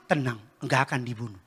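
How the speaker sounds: very low, soft reassurance; calm husband. Retold or imitated. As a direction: soothe her with total composure. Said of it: "Saya bilang". (suami menenangkan dengan suara rendah dan pelan)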